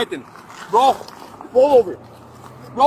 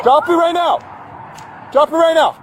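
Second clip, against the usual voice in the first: loud, high barked order; shouting command; 9 semitones higher, 4 dB louder than usual. Real, not acted. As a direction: yell the command with urgency. Deputy commands woman holding rock.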